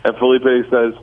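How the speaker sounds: normal talking voice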